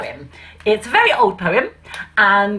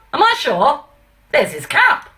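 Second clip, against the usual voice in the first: Pa, indignant; 6 semitones higher than usual. Acted. Pa answers keeper in poem.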